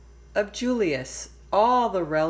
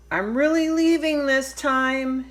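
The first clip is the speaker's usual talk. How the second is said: loud defiant call, raised high; 7 semitones higher, 5 dB louder than usual. Acted. Lily calls out as she runs away, loud and defiant. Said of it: "she called".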